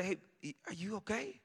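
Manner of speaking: hushed, tentative concern; gentle checking. Retold or imitated. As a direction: gently check on a frantic wife. Checking on screaming wife.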